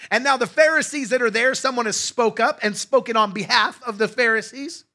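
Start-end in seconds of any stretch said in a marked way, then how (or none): none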